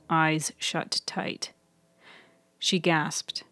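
plain reading voice

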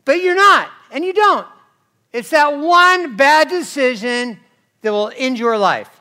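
loud, high warning, slowing on the bad decision; preaching at full force. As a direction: hammer the warning home.

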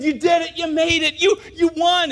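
high, loud celebration; cheering. Retold or imitated. Angels cheering a lone camper, high and loud. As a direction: pump him up. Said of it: "try to get him excited".

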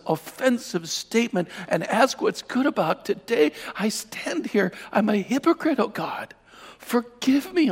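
high, pleading prayer near tears; re-enacted tearful confession, pitched high; weeping repentance. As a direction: beg God's forgiveness, anguished. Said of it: "I began to weep".

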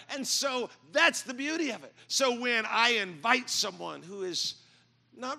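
fervent preacher's crescendo; full-force preaching. Acted. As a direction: build the congregation to a climax. Preacher builds to climax.

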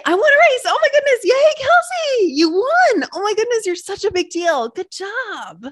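high, loud self-congratulation, softening at the end; a squealing yay for herself after winning a race; cheering for herself. Pretend. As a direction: gush over your own win like a proud cheerleader. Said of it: "let me just say".

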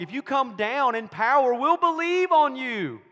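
high, loud jeer, last words stretched out; mocking shout. Crowd taunting Jesus on the cross, mocking shout. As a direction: jeer it at full volume, rising in pitch. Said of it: "they're mocking him, going".

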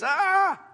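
high-pitched "Ah!" of frustration; short burst of mock exasperation; exasperated cry. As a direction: cry out as if your peace just broke. Acting out irritation at interruptions.

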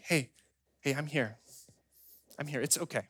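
hushed and tender; gentle reassurance. Acted. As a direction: soothe a frightened child, with pauses. God reassuring a panicking child.

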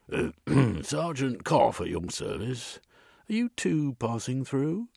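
a London copper stopping strangers on the street; gruff police sergeant. A narrator's reading.

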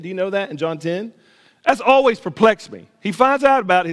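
loud, high preaching voice; impassioned preaching. Real, not acted.

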